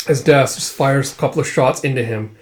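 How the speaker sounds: usual speaking voice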